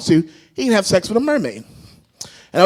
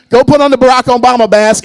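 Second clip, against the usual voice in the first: usual speaking voice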